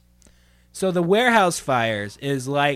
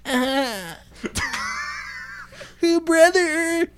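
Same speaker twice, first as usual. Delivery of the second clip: nervous collar-tugging comic; hammed-up nervous comic shtick; Rodney Dangerfield style; 7 semitones higher than usual. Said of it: "Rodney Dangerfield style".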